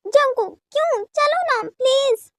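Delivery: high, coaxing whine; pleading. Acted. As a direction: wheedle in a high, drawn-up voice. वी ज़िद करके मना रहा है. (मनुहार भरी ज़िद)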